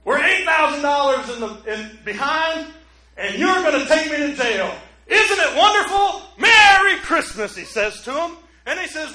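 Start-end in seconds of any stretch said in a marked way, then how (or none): 0.1-7.6 higher and louder, elated; thrilled to be going to jail; giddy delight. Retold or imitated. George telling the investigator he's delighted, giddy delight. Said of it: "He goes".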